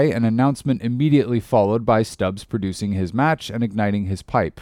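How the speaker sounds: usual speaking voice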